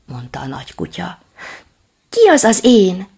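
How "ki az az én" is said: high, loud anxious question; scared question. Acted. Little dog asks who is there, high and loud. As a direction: ask who is there, frightened.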